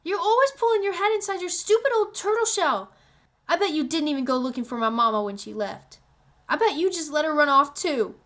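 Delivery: put-on high, accusing child's outburst; girl lashing out at her grieving father; angry girl. A narrator's reading. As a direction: blame him for everything. Girl keeps shouting at father.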